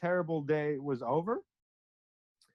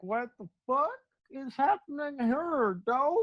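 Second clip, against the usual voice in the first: high, slow, stretched-out disbelief; mock bewilderment in a high, slow voice; astonished; 7 semitones higher, slower than usual. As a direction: act out being mind-blown, pitched way up and drawn out. Acts out astonished reaction to vision.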